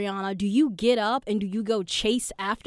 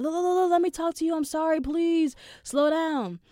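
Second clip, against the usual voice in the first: desperate pleading; 8 semitones higher than usual. Retold or imitated. Chasing and pleading while out of breath.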